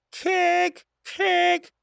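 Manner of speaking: high, hoarse repeated screech; hoarse falcon cry. A narrator's reading. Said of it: "хрипло ответил сокол".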